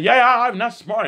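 high, loud eager yeah yeah; eager agreement. Retold or imitated. Retelling a talk with Peter, eager and high. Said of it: "I said".

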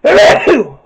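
sudden loud sneeze; one sharp sneeze; sneezing. Real, not acted. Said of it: "I just sneezed".

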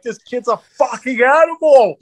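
high, drawn-out holler in imitation; mock-holler it slowly, stretching the words; amazed yell. Hillbilly fan to the crowd after a knockout, imitated amazed holler.

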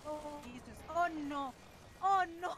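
raging gamer. Real, not acted. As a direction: shout it in rising alarm. Player panicking in game.

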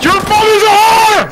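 screamed slowly into the mic; loud yell. Real, not acted. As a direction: yell it at full force. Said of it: "I yelled that right in the mic".

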